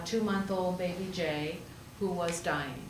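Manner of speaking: normal talking voice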